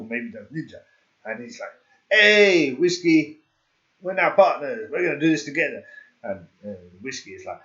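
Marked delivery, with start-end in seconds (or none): 2.1-5.8 put-on loud, higher, cocky young partner; brash rookie bursting in; cool young cop. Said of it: "all, like, funky and cool".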